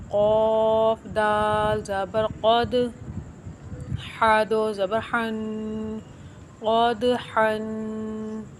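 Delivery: very slow, letter by letter; slow letter-by-letter Quran reading; slow spelled-out recitation. Shown as a demonstration. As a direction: spell out क़द हन, then join it. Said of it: "सही तरीका पढ़ने का".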